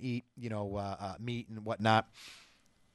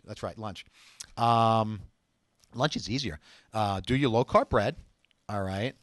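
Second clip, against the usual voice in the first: ordinary delivery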